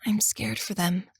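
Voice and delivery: soft unvoiced whisper. A narrator's reading. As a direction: whisper so the cousin won't wake. Whispering in the dark.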